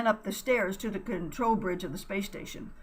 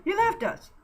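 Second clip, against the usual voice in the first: very high distressed cry; 12 semitones higher, 5 dB louder than usual. Acted. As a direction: wail it in despair. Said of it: "Buddy cried".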